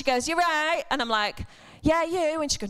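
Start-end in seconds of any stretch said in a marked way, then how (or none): 0.3-0.8 higher-pitched mock call; imitated shout. Retelling the neighbour's greeting across the street, mock shouting. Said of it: "and she shouts". 1.8-2.4 higher-pitched retold reply; calling back. Preacher replays her reply to the neighbour, calling back.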